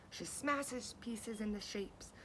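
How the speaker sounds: usual speaking voice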